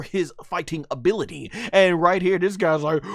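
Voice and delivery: high, excited fight commentary; live play-by-play. Acted. Fan narrating an anime fight to viewers, hyped play-by-play. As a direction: call the action like a ringside announcer.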